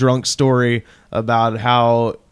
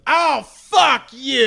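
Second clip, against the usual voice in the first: high, slow mock drunk retort; hammed-up tipsy old lady telling him off; drunk caller snapping; 12 semitones higher, much slower than usual. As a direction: drag out a high "Oh, fuck you" like a sloshed caller. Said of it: "She goes".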